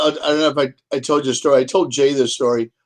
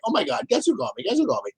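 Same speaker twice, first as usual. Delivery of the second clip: high, soft, giddy re-enactment; retold excitement; 6 semitones higher, 6 dB quieter, much faster than usual. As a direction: replay the thrilled phone call in a higher, softer voice. Retells thrilled call to wife.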